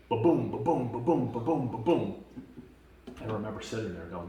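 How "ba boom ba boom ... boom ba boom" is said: loud, higher booming knocks that fade; pounding on a shed sounded out loud; imitated pounding. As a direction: beat the words like fists on a shed. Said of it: "started pounding on the woodshed".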